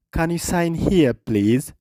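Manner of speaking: slow, careful English with stress on room; slow repeat for learners. Shown as a demonstration. Teacher repeating a clip line slowly for students, slow and careful. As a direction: say it slowly so the class can copy.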